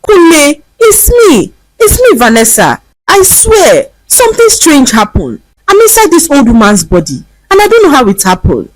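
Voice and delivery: high, pleading desperate cry. A narrator's reading. Vanessa at her own gate, begging Kunle in desperation. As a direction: beg him to recognise you. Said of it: "Vanessa cried out".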